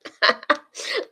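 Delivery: brief burst of laughter; real short laugh. Real, not acted. Streamer amused by the chat, short laugh. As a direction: laugh before you answer.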